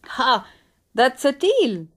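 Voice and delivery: a touch of relieved confidence; quick, keen acceptance; eager. A narrator's reading. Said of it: "Hans said".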